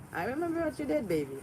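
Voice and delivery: high, pointed warning; menacing. Real, not acted. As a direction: let them know you remember, with menace. Threatening someone who wronged her.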